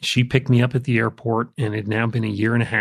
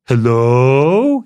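silly voice; 3 semitones higher, 9 dB louder than usual. Retold or imitated. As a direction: answer the phone in a silly, loud, sing-song teen voice. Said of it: "in this real silly voice".

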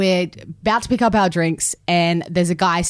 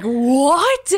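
higher, stunned disbelief; retelling two strangers in shocked disbelief; surprised; 8 semitones higher, slower than usual. Retold or imitated. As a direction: gasp it out like you can't believe it. Both shocked at matching names.

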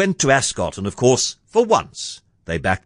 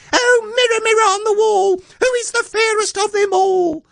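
very high-pitched, screeching queen's shout; shouting spoiled queen; 4 dB louder than usual. A narrator's reading. Spoiled queen to her magic mirror, high imperious shout. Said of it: "stupid queen would shout".

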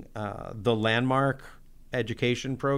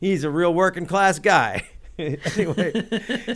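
laughing; 6 semitones higher, 3 dB louder, slower than usual. Real, not acted. Laughing about [name].

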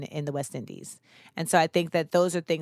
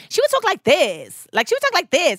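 high, loud mock American accent; imitated American accent; 10 semitones higher, 4 dB louder than usual. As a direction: mimic an exaggerated American accent, pitched way up. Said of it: "speak in her American accent".

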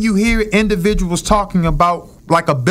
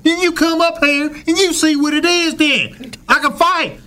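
much higher, near-shouted Cleveland defiance; thick Cleveland accent; 11 semitones higher than usual. Retold or imitated. Quoting the Cleveland man daring critics to fight, high shouted accent. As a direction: yell it like a proud brawler from Ohio. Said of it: "in his thickest accent is saying".

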